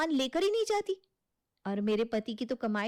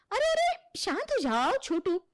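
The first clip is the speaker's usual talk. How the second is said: much higher, louder cry of arre; stop-it call; 6 semitones higher, 4 dB louder than usual. Acted. Aunt shouts to stop the two boys fighting. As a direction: break up the kids' quarrel.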